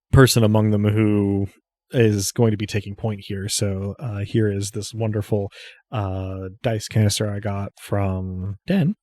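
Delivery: normal talking voice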